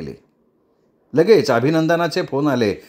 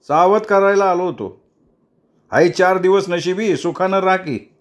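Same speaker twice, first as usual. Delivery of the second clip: a higher, lightly voiced threatening young man; Jatin's threat; 7 semitones higher than usual. Jatin warning the leader, a light threatening tone. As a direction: warn him coolly, as a threat.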